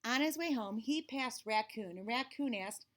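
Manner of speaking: ordinary delivery, nothing marked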